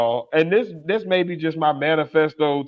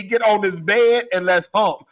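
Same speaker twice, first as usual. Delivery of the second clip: ordinary delivery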